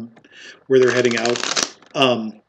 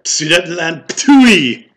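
higher, loud disgusted spit; spitting contempt; 5 semitones higher, 7 dB louder, much slower than usual. Acted. Barkeep scorns the Sudetenland strangers, spitting contempt. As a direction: throw out the name, then spit it away loudly. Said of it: "I spit on your Sudetenland".

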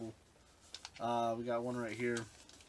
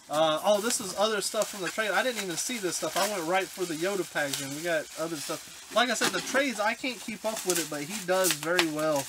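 excited; 5 semitones higher, 5 dB louder than usual. Real, not acted. Excited finding extra trade items.

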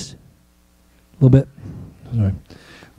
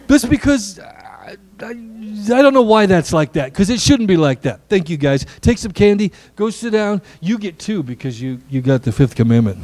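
ordinary talk, nothing marked

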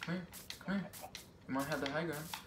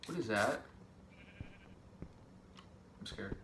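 ordinary talk

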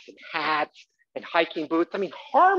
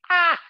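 short high yelp; 12 semitones higher, 6 dB louder than usual. Real, not acted. Sudden outburst.

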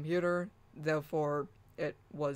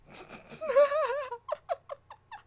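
high helpless laugh; 4 dB louder than usual. Real, not acted. Laughing at robot voice.

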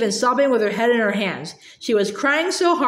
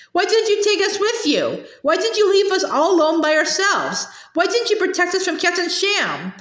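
high-pitched, indignant run of questions; hurt accusation; 7 semitones higher than usual. A narrator's reading. Boy asking aunt why she left them, accusing. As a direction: plead and accuse with raised pitch.

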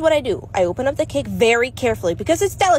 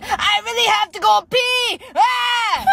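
high, slow, drawn-out plea to pull over; desperate whine; 8 semitones higher, slower than usual.

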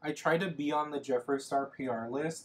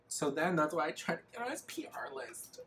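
hushed confessional whisper; 2 semitones higher than usual. Real, not acted. Confessing the PR list scheme to viewers in a whisper. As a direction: whisper it like a guilty secret.

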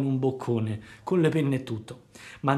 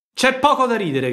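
much higher, louder, piqued puppet; offended shout; 10 semitones higher, 8 dB louder than usual. A narrator's reading. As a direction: snap back, stung by the laughter. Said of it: "gridò Pinocchio impermalito". (Pinocchio offeso grida, più alto e più forte)

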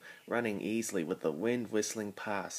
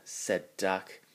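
plain reading voice

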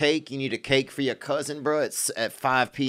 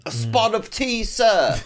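mock posh British; 8 semitones higher, slower than usual. Mocking a fancy British accent.

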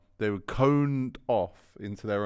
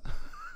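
high laugh; 4 dB quieter than usual. Real, not acted. Laughing at the story.

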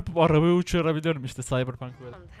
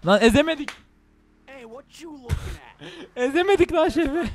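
laughing outcry; 9 semitones higher than usual. Real, not acted. Failed run over chef, laughing.

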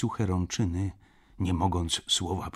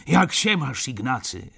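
hearty raised greeting, starting high and loud; calling out; 4 semitones higher, 5 dB louder than usual. A narrator's reading. Franz falling into Ignacy's arms, calling out. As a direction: call a greeting, dropping on the name. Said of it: "zawołał, padając mi w objęcia".

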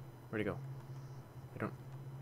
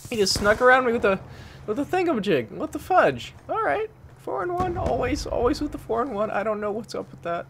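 high, loud exasperated rant; 10 semitones higher, 6 dB louder than usual. Real, not acted. Gamer ranting about dying again, loud high exasperation. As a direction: vent it all out up high.

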